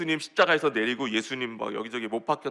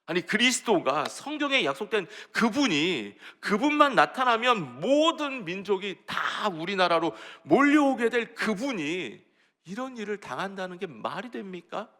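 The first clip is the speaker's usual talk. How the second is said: higher-pitched, pleading objection; indignant protest; 6 semitones higher, 4 dB louder than usual. Acted. Peter arguing with Jesus over his coming death, indignant protest. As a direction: argue it out, stretching the big words. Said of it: "예수님 붙들고 항변했습니다".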